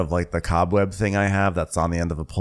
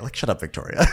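usual speaking voice

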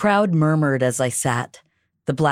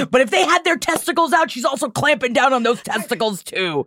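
gleeful joking; 10 semitones higher, faster than usual. Real, not acted. Joking, laughing.

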